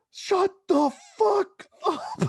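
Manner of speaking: high, loud, drawn-out shout; retold angry yell. New Yorker answering the gushing girl, high mock-angry shout. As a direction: yell it slowly, imitating a fed-up New Yorker. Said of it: "And he goes".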